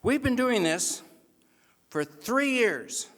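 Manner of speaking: a towering captain booming down at the ref; loud, slowed, emphatic captain; big captain's verdict. Retold or imitated. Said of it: "he says".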